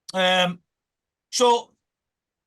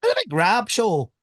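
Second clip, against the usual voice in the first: put-on Ulster accent. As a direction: mimic a radio host's Ulster accent. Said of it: "I do a good Ulster accent".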